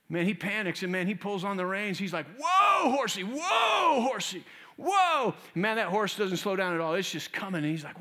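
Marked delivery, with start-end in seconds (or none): 2.4-5.3 high, loud, drawn-out whoa; panicked shout. Retold or imitated. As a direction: yank the reins and yell at the horse. Rider panicking at the cliff edge.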